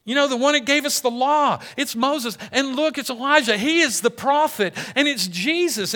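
high, loud, excited outburst; freaking out. Acted. Peter seeing Moses and Elijah beside Jesus, freaking out. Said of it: "And Peter freaks out".

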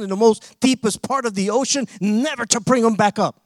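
loud, driving preacher cadence; full-force preaching. Acted. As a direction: preach it hard to the congregation.

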